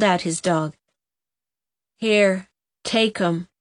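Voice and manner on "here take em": ordinary delivery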